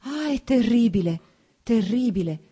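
Dolly appalled by the divorce news; horrified pity. A narrator's reading. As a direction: gasp at the horror of it. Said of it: "ebbe pietà di lui".

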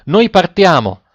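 urgent narrated sailors' cry; imperious, impatient shout. A narrator's reading. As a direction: call out impatiently as if about to leave. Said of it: "gridarono in coro i marinai con imperiosa impazienza".

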